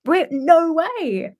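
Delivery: slow high no way; stunned disbelief. Retold or imitated. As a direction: stretch it out in disbelief. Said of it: "I was like".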